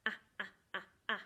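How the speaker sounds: a said as the short sound uh. Shown as a demonstration. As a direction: say a as the phonics sound. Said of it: "I call it a".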